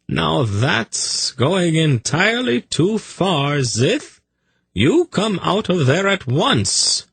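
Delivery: high, slow, prim telling-off; indulgent scolding. A narrator's reading. Zadi ordering his snake out of the bottle, scolding. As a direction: scold like a fussy pet owner.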